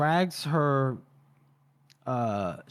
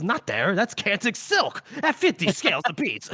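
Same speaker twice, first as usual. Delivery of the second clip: high, exasperated mock scolding; mock yell; 5 semitones higher than usual. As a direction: imitate a panicky yell, pitched high. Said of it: "Orso yells at him".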